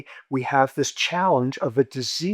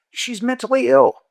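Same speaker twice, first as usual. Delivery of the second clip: matter-of-fact; 11 semitones higher, 7 dB louder than usual. Retold or imitated. Friend answers like he's an idiot.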